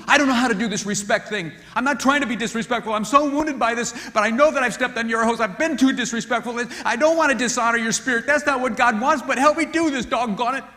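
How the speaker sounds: high, loud, heartfelt apology; a wounded wife begging to get respect right; pleading wife. Retold or imitated. Wife apologizing to husband for disrespect, loud earnest plea. Wife voiced asking for help.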